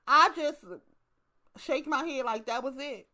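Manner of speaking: ordinary talk, nothing marked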